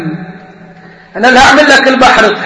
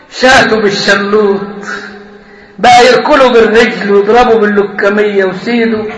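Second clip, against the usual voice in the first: ordinary talk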